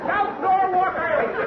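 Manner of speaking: high, slow, hammed-up Irish brogue; Irish tenor brogue. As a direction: croon it like a stage Irishman. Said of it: "am an Irish tenor".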